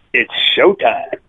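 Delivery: slow, drawn-out showman call; showtime announcement. Acted. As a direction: announce it like a circus ringmaster. Performer announces his act.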